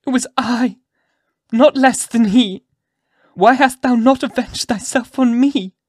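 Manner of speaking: high, loud, impassioned confession; anguished cry. A narrator's reading. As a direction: cry out, begging to take the blame. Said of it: "cried Hester, shuddering".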